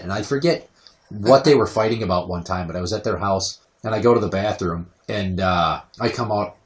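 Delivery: ordinary delivery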